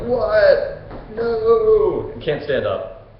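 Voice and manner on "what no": loud, high mock dismay; Theseus. Theseus learns he is glued to the bench, dismayed. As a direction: cry out in hammed-up disbelief, drawing it out. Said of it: "Theseus is like".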